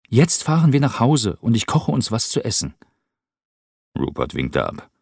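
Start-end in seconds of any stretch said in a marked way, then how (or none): 0.1-2.7 viel höher, lauter, fröhlich drängend; junger Cameron, munter. Acted. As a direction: heller junger Mann, der Rupert aufmuntert. Cameron voiced by narrator. (hohe, lebhafte Jungenstimme)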